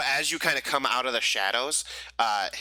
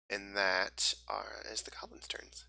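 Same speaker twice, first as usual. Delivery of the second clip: whisper; 8 dB quieter than usual. Real, not acted. GM drops voice, whispered aside.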